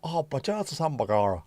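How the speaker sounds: mock the Irish-American movie-cop accent; hammed-up old-film Irish cop accent. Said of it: "talking with that stupid fucking voice".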